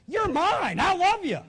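high, loud proclamation of love; emphatic declaration. Acted. God's emphatic declaration in a quote.

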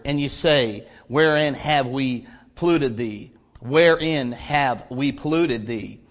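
measured, solemn reading of scripture. Acted. Preacher reading Malachi to the congregation, solemn and measured.

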